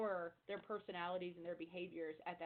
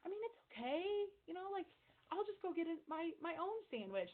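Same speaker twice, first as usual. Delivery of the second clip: much higher, mild, unbothered voice; 10 semitones higher than usual. Retold or imitated. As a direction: play the teammate who shrugs it off. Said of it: "the lowest D is like".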